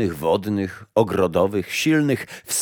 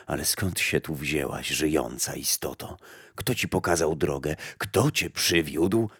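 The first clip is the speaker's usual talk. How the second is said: put-on low, much softer old woman; old grey woman; 5 semitones lower, 6 dB quieter than usual. Old woman tells the blind mother death is not back, low and soft. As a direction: answer like a quiet old gardener of graves.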